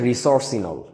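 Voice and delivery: রিসোর্সিনল said as one smooth word, ri-sor-si-nol; correct pronunciation. Shown as a demonstration. Teacher to students, correcting a pronunciation. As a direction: give রিসোর্সিনল its correct full form. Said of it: "আসলে উচ্চারণটা হচ্ছে".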